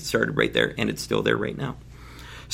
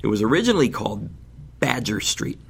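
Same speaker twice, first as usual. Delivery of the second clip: normal talking voice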